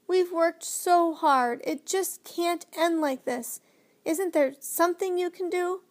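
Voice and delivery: high, slow, pleading through tears; drawn-out, upset child's appeal; tearful plea. A narrator's reading. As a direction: beg as if close to crying. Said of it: "Huge tears rolled down Lindy's face".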